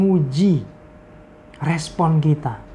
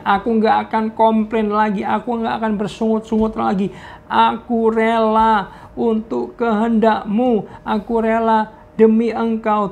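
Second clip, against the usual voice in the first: higher, tearful promise of surrender; 6 semitones higher than usual. Retold or imitated. Friend vows to stop complaining to God, higher and tearful. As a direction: pour out the surrender through tears. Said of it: "Dia sambil nangis".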